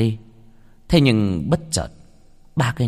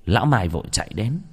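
ordinary delivery

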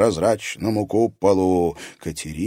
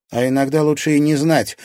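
curt, louder retort; sharp; 7 semitones higher, 6 dB louder than usual. A narrator's reading. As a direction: cut her off sharply. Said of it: "резко ответила Катерина".

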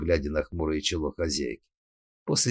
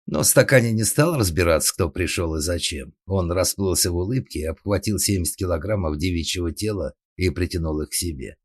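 usual speaking voice

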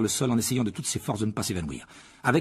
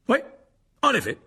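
raised, louder put-on voice; flustered Lockhart; 13 semitones higher, 6 dB louder than usual. A narrator's reading. Lockhart after botching the spell, raised voice. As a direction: speak up higher and louder.

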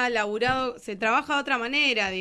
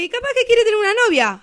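fast, higher mock-teacher retort; a lightly acted teacher's reply; quoting herself to a pupil; 12 semitones higher, 10 dB louder, faster than usual. Teacher retelling what she told an eleven-year-old, lightly acted. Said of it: "Y le dije".

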